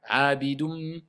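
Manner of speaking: "Abidum" ending in a held nasal m; assimilated nun. Shown as a demonstration. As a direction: model "Abidum" with a hummed ending.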